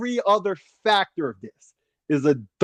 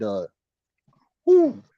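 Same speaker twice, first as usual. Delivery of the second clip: ordinary delivery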